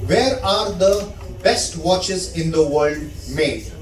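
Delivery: usual speaking voice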